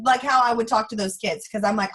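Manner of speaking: usual speaking voice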